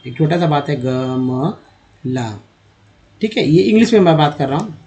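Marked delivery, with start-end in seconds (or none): 0.8-2.4 very slow, softer, drawn-out गमला; slow demo word. Shown as a demonstration. Teacher modelling गमला slowly, very slow and soft. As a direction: stretch गमला out slowly for the class. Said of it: "मान लीजिए लिखना है गमला".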